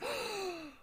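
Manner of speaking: sharp high gasp of joy; delighted gasp. Retold or imitated. Drunk Bailey gasping when her hot dog is found. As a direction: gasp like you found treasure.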